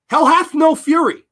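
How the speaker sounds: loud, high dramatic declaration; emphatic proclamation. Real, not acted. Repeats saying with dramatic emphasis.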